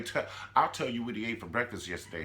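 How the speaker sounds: ordinary talk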